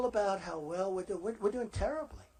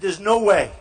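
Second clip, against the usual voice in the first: loud retort; 13 dB louder than usual. Retold or imitated. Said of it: "I said".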